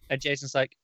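usual speaking voice

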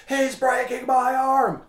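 high, slow, silly whine of pain; jokey exaggerated wail; mocking whine. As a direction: parody the wrestler's complaint.

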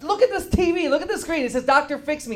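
high-pitched indignant insistence; worked-up, affronted correction; exasperated protest. Acted. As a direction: plead your case, voice climbing in exasperation. Protesting his misread name.

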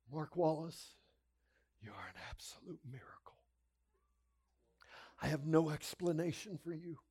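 retold tearful awe, halting with long pauses; tearful doctor. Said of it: "and she's crying".

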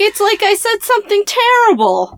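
high-pitched incredulous protest; mock indignation. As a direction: squeal it like a wronged innocent. Mimics Wood's baffled indignation.

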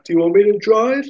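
high-pitched, delicately polite offer; politely tentative, lifted voice; polite suggestion voice. Acted. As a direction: offer it gingerly, pitch right up. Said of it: "sort of voice".

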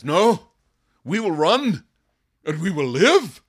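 high, loud mocking voice of a scared man; preacher mocks a soldier who wants to flee; mock cowardly soldier. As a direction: play the frightened soldier for laughs. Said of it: "And the guy says".

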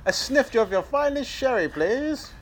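high, mock-genteel English accent; put-on English accent. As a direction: order grandly as an English caricature. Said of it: "but more English".

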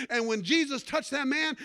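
normal talking voice

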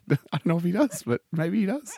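high, light voice for Anna; high put-on woman's voice. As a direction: speak up high as the girl. High pitched female character voice.

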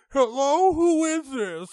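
high, loud comic teacher voice; teacher answering phone. Retold or imitated. Teacher answering a prank phone call, high voice. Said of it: "some teacher, and it's like".

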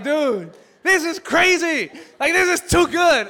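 high, loud, laughing disbelief; almost shouting with delight; thrilled shout of disbelief, loud and high; amazed. Retold or imitated. Amazed at judge's leniency.

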